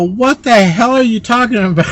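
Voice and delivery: high, loud, disbelieving what-the-hell; shocked outburst. Retold or imitated. To the assistant who says he passed tests, shocked outburst. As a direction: blurt it out in shock.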